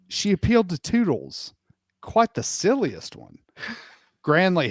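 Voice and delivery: normal talking voice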